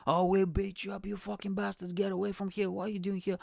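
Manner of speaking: high-pitched mock threats; imitated angry cursing. Pub crowd driving off foreigners, imitated angry cursing. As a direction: threaten them in a put-on local's voice. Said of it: "they're cursing at us".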